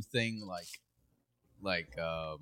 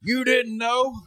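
higher, loud promo shout; mock wrestler yell; 14 semitones higher, 9 dB louder, much slower than usual. Podcaster shouting a wrestler's catchphrase. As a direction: roar it like a heel in the ring.

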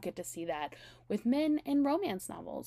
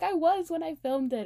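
defensive; 8 semitones higher, 5 dB louder than usual. Retold or imitated. His defensive reply, retold.

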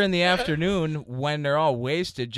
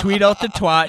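normal talking voice

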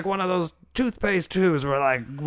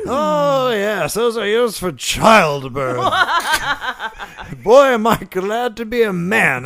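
gloating character voice; 5 semitones higher, 7 dB louder than usual. Acted.

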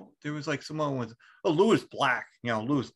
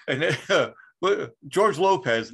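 normal talking voice

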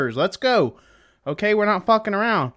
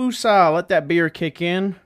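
ordinary talk, nothing marked

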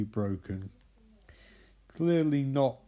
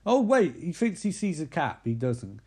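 ordinary delivery

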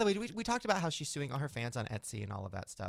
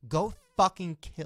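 imagined fury; 4 semitones higher, 10 dB louder, slower than usual. Retold or imitated. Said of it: "I'd be like".

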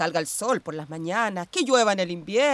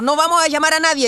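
fast, much higher and louder string of no's; loud frantic refusal; 9 semitones higher, 8 dB louder, faster than usual. Acted. Sister cutting off the idea of calling, loud and fast. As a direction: snap the refusal out in a panic.

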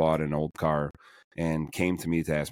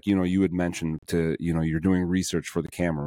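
ordinary talk, nothing marked

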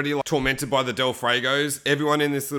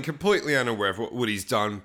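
ordinary talk

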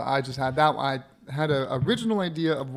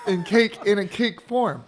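laughing; 6 semitones higher, 5 dB louder than usual. Real, not acted. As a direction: laugh out the words. Laughing over cake mix-up.

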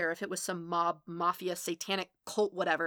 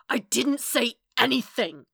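loud, higher, furious denial; snarled; 5 semitones higher, 7 dB louder than usual. A narrator's reading. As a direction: snarl it out at full anger. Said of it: "Matt snarled".

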